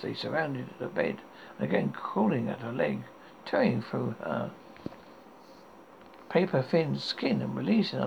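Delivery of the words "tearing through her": ordinary delivery, nothing marked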